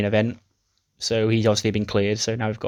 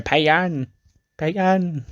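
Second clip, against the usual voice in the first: high-pitched cry after someone running off; mock calling out; 7 semitones higher, much slower than usual. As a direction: call the name after her, hammed up. Billie chases after her, calling.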